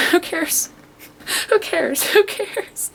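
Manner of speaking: high, soft singsong shrug; playful dismissal. Real, not acted. Vlogger brushes off her broken nail, playfully dismissive. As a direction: toss it off in a high little voice.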